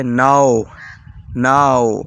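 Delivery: ناؤ drawn out from AA into O; AO diphthong. Shown as a demonstration. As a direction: glide slowly through "naa-o" in ناؤ. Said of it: "او آؤ کا تلفظ بھی دیتا ہے". (ناؤ میں آؤ کا تلفظ)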